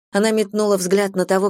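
ordinary delivery, nothing marked

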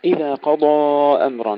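قضى with thick emphatic D and full heavy alif; heavy dad. Shown as a demonstration. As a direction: keep قضى heavy to the end. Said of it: "نحافظ على تفخيم الضاد والألف". (تفخيم الضاد والألف)

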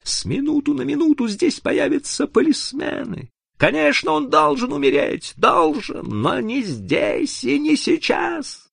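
very high, urgent woman's voice; narrator's high-pitched agitated woman; hissed. A narrator's reading. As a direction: hiss an urgent warning in a high, strained voice. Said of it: "прошипела она".

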